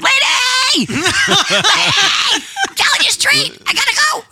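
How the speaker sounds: screaming. Retold or imitated. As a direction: let the retold yell burst out. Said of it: "I was screaming at the window".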